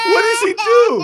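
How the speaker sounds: high, loud cry of disbelief; alarmed, amused shriek of a question; shocked outcry. Real, not acted. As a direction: blurt it out, voice leaping way up. Reacting to video of crying child.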